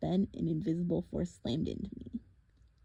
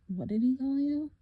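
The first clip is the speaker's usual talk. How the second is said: ordinary delivery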